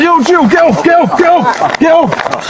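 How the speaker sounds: panicked cries while pinned down; alarmed protest. Real, not acted. Pinned to floor, protesting loudly.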